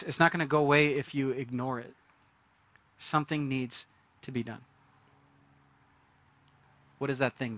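normal talking voice

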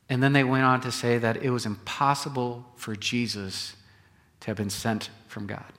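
usual speaking voice

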